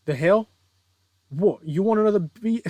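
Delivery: badly faked British villain, rising in challenge; bad British accent. Villain taunting the hero who burst in, badly faked British accent. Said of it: "I can't do a British accent".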